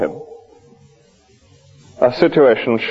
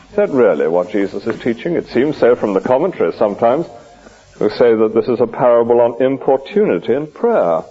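ordinary talk, nothing marked

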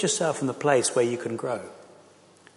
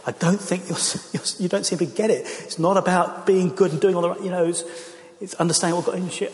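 higher, pleading outburst close to tears; retold tearful outburst; 7 semitones higher than usual. Retelling Penny shouting at the couple, tearful and raised. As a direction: plead with them, voice raised and breaking. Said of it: "sort of shouted at them, and just burst into tears".

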